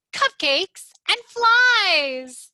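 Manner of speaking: high, loud, slow and drawn out; delighted cry. Acted. Froggy at snack time, high loud delighted cry. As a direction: savor it like a hungry frog at snack time. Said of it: "cried Froggy".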